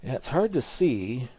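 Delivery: ordinary talk, nothing marked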